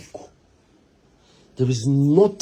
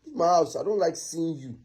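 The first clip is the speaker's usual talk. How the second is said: usual speaking voice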